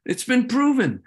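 high, loud insistent outburst; emotional. Real, not acted. Speaker defending Iran to the audience, heated and insistent. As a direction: press the point with heat. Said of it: "I get a little emotional".

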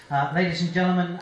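usual speaking voice

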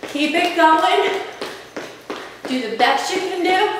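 ordinary delivery

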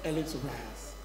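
very soft, low bedtime coaxing; gentle begging. Acted. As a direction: coax children to sleep in a hushed voice. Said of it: "begging his children to sleep".

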